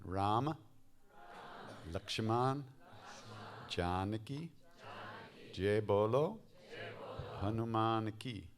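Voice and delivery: phrase by phrase with long pauses; spaced out for listeners to follow; teaching the mantra. Shown as a demonstration. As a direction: lift the end of each phrase, falling on the last. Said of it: "you have to remember that one".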